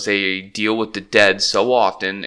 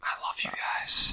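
soft unvoiced whisper; whispered tender aside; 10 dB quieter than usual. Real, not acted. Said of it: "Why are we whispering?".

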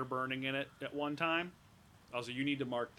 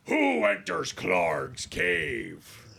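slow, loud, booming crazed voice; slow looming nutty goblin boss; nutty goblin chief; 4 semitones higher, 9 dB louder, much slower than usual. Acted. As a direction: boom it out like a mad cave king. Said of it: "He talks in third person".

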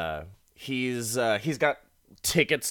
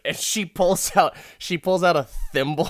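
usual speaking voice

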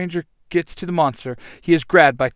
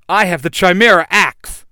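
triumphant; 5 semitones higher, 9 dB louder than usual. Acted.

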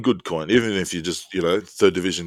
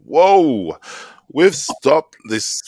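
hammed-up indignant protest, louder; mock outrage; 5 semitones higher, 5 dB louder, slower than usual. Co-host mock outraged at a fan's question. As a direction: play at being scandalised by the question.

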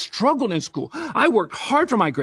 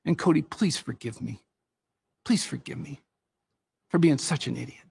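sincere apology; 5 semitones lower, 6 dB quieter than usual. Retold or imitated. Father apologizes to his son.

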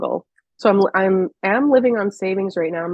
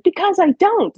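put-on childish retort; 11 semitones higher than usual. Son's reply voiced.

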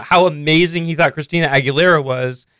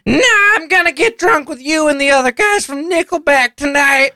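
put-on much higher dopey man-child voice; Ralph voice; 9 semitones higher than usual. [name] as Ralph, brushing off his dad in a high dopey voice. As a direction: whine it like a clueless slacker. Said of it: "my Ralph voice".

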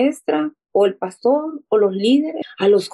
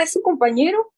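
bright high rise, quicker; excited; 7 semitones higher than usual. Retold or imitated. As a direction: tell it like thrilled news. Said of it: "como emocionada". (emocionada, tono alto)

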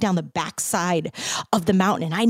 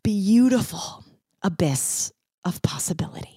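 whisper. Acted. Whispered closing phrase, unvoiced.

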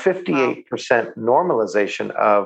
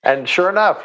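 ordinary talk

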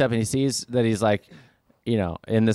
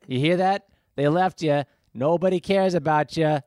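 taunting soldier; 7 semitones higher than usual. Acted.

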